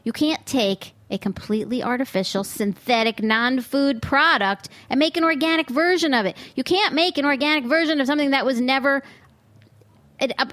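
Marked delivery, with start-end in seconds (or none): none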